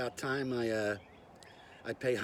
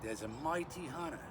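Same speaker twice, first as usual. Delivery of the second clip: fake Australian accent; 4 dB quieter than usual. Bushman introducing himself to YouTube, fake Australian accent. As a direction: put on a bad Australian accent. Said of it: "with my fake Australian accent".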